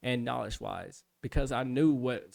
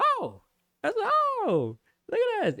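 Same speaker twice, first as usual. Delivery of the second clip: squeaky, very high, delighted; giddy surprise; 4 dB louder than usual. Retold or imitated. Trainee reacts to the instructor's praise in a squeaky, delighted voice. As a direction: gasp with pride.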